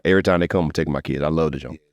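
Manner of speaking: normal talking voice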